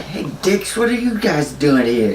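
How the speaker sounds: put-on drunk buddy heckling. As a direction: call out like a tipsy intruder, half put on. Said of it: "And he's like".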